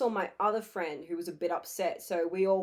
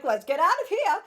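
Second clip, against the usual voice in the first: high, loud urgent get out; urgent high escape; 8 semitones higher, 7 dB louder than usual. Retold or imitated. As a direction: hurry them away. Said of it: "Lily was like".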